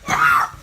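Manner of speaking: breathy, loud mock scream; loud whispered scream. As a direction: shriek in alarm at the attempted kiss. Retelling a dodged kiss.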